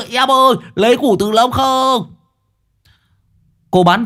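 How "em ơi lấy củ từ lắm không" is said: very high, loud, manic shout; madman's yell. A narrator's reading. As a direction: holler after the girl like a lunatic. Madman chasing the girl.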